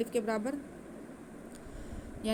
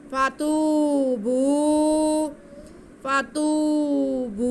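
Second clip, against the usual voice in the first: slow, drawn-out melodic tajweed recitation, higher and louder; Quran recitation; 4 semitones higher, 7 dB louder, much slower than usual. Shown as a demonstration. Teacher demonstrating the recitation to students, slow melodic chant. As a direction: chant it slowly with nasal ghunna. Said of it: "एक अलिफ के बराबर खींचेंगे".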